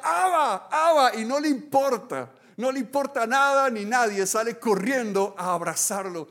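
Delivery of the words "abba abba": higher, loud cry of Abba; child's shout. Pretend. Believer running to God like a child, shouting. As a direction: cry out like the little girl. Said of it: "Y grita".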